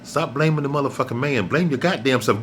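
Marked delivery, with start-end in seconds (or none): none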